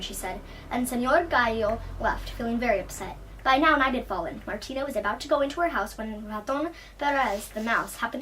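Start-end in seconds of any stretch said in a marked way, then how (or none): none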